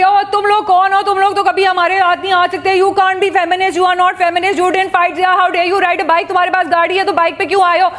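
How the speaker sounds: high, fast, jeering bully rant; a bully berating girls who rode bikes, high and fast; mocking bully. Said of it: "अगर वो ये भी".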